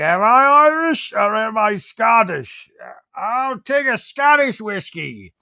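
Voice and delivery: put-on slow, high, drunken Scottish accent; [name]'s Scottish accent. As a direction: ham up a bad Scottish accent. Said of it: "goes up as his Scottish accent".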